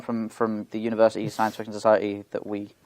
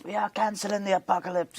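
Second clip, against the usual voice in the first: high, hushed rallying cry; movie speech impression; 8 semitones higher, 7 dB quieter than usual. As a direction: imitate a big movie speech, pitched up but soft. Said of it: "A great speech".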